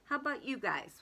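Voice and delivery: plain reading voice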